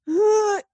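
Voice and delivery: sharp, high gasp; mock-panicked intake of breath; anxious gasp. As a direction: suck in a breath as if alarmed. Panicky reaction to the news.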